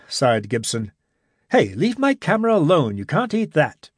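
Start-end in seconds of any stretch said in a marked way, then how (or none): 1.5-3.9 raised startled voice, quickly settling; startled protest. A narrator's reading. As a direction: snap it out to stop a thief. Creature grabs Gibson's camera.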